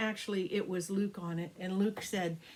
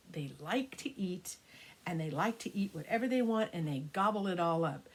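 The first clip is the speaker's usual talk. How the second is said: ordinary delivery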